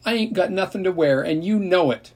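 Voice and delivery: high, loud whining protest; wailing whine. A narrator's reading. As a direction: wail it like a siren, childish and loud. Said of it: "A wail went up from Ellie and Brenda like two sirens".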